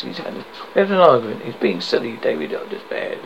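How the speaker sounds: usual speaking voice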